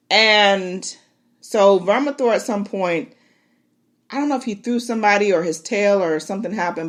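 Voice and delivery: ordinary delivery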